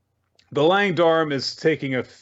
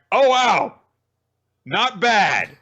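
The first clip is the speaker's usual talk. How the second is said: mock impressed; 8 semitones higher, 4 dB louder, much slower than usual. Crowd impressed.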